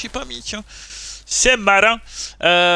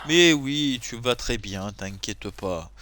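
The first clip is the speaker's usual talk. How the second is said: mock reassurance; 6 semitones lower, slower than usual. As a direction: soothe them, dropping lower and slower. Soothes the complaining game character.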